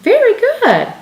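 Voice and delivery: high, loud cheerful praise; enthusiastic praise. Shown as a demonstration. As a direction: praise the child brightly. Praising the child.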